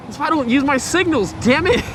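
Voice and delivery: annoyed at himself, half laughing; exasperated. Real, not acted. Cursing at the forgotten signal.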